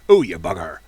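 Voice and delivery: rolling West Country "Oh, you bugger!" with burred r; a mock West Country yokel shouting "you bugger"; West Country accent. Shown as a demonstration. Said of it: "say bugger in a West Country accent".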